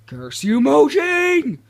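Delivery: theatrical yell rocketing up very high; mock-villain curse. Player cursing the game's makers over an update, mock-outraged yell. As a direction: build to a loud, high, hammed-up scream.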